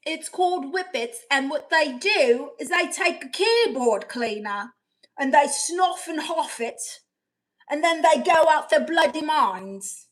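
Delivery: loud, emphatic put-on British accent; mock-outraged British accent, punching the words. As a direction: explain it in a hammed-up British voice. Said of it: "You sound like an Australian".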